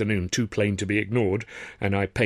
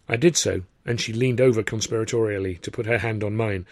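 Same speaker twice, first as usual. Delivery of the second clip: usual speaking voice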